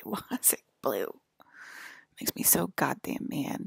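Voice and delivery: usual speaking voice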